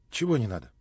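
usual speaking voice

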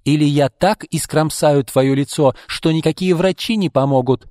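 acted violent threat; menacing threat. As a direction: threaten her in anger. Threat with scissors to face.

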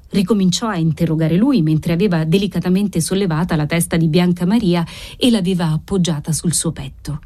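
ordinary delivery

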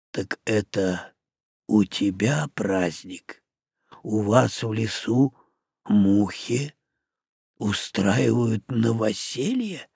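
deep and bewildered; puzzled orc. Acted. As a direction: orc surprised flies hold housewarmings, low and puzzled.

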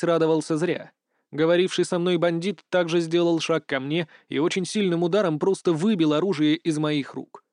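plain reading voice

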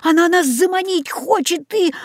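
high, urgent warning; alarmed cry. A narrator's reading. Boy warns friends of the trap, alarmed cry. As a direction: warn them urgently, pitched high. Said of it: "закричал я".

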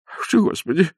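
put-on high, flustered old man. A narrator's reading. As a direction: fuss and flutter. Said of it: "заволновался старик".

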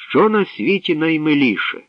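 high, ringing question; ringing princess voice. A narrator's reading. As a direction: let the riddle ring out over the crowd. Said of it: "продзвенів над майданом голосок царівни".

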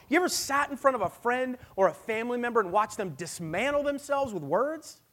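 loud, high, impassioned preaching, slowing on the key words; full-force preaching. Acted. Pastor pleading with the congregation in a loud, impassioned preach. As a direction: preach it hard to the whole room.

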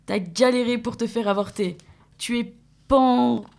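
rhythmic listing with falling ends; resume the spoken-word list; steady protest-text reading; manifesto reading. Acted.

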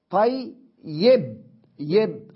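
طي، يب pulled apart as "tay... yib", high and loud; split syllables. Shown as a demonstration. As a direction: break the word slowly into طي and يب. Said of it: "الياء الثانية هي المتحركة".

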